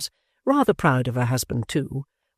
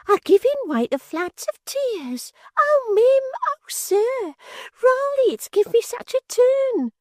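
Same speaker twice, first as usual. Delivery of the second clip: a squeaky, much higher, hammed-up fussy maid; overwrought maid; 4 dB louder, slower than usual. A narrator's reading. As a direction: play the silly maid swooning in high dramatic squeals, slowly. Voices the maid Miggs.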